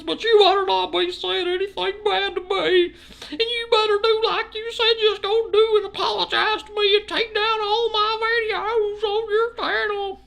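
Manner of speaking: very high, loud, wailing whine; mock Square. Imitating Square demanding an apology, high loud crybaby wail. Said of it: "And he's like".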